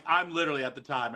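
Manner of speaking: ordinary talk, nothing marked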